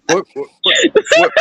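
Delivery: usual speaking voice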